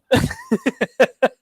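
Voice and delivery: high, knowing giggle; imitated giggle. Bench teammate anticipating chaos with a high, knowing giggle. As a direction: giggle like you know what's coming. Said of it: "he's just going like this".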